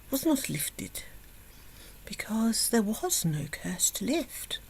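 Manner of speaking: soft unvoiced whisper; hushed punchline. A narrator's reading. Narrator whispers that there was no curse, softly. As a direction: whisper the reveal.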